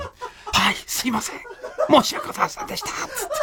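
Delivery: breathy, whispered timid apology; meek apology. Retold or imitated. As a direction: cower like a scolded apprentice. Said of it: "disciple's apology voiced".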